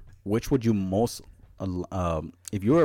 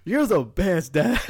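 laughing; 10 semitones higher, 6 dB louder, slower than usual. Retold or imitated. Laughing through kid quote.

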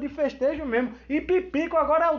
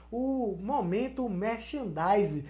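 ordinary talk, nothing marked

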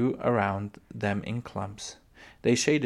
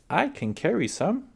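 put-on high little-girl voice, eager to help; eager panting child; 9 semitones higher than usual. Laura offering Pa help, eager and panting. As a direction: pitch it up like a keen child. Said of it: "she panted".

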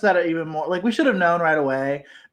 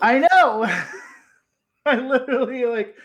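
laughing; 8 semitones higher, 4 dB louder than usual. Real, not acted. Host laughing at own reaction.

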